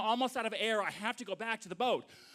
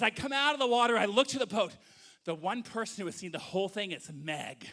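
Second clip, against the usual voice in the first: ordinary talk, nothing marked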